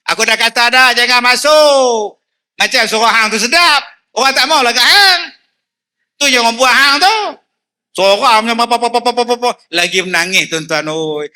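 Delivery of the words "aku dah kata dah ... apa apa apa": higher and louder, snapping at his wife; scolding husband. Acted. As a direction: tell her off for joining the marhaban. (suami memarahi isteri dengan suara tinggi)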